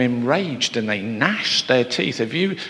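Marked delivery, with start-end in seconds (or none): none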